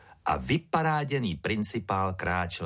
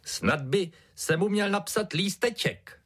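put-on high-pitched child making a suggestion; Čudla; 13 semitones higher than usual. A narrator's reading. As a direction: pipe up as a small kid. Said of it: "řekl Čudla".

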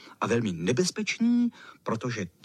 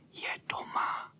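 hushed, breathy whisper; 7 dB quieter than usual. A narrator's reading. Boys below ask the lookout about the cat, whisper. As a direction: whisper up so the cat won't hear. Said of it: "šeptali ti dole".

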